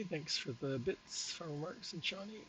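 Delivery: normal talking voice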